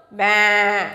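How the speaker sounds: low, drawn-out baa; sheep bleat imitation. Shown as a demonstration. Teacher shows children how a sheep will sound, low imitated bleat. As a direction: bleat like a sheep, held on one note. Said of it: "sheep will sound?".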